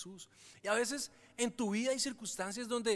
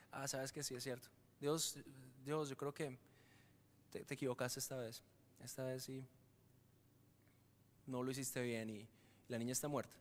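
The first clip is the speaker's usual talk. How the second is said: much lower and softer, giving up on God; resigned doubt; 7 semitones lower, 8 dB quieter than usual. Retold or imitated. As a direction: mutter defeated doubt, voice sinking. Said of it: "nos dejamos contagiar de". (duda resignada, grave y baja)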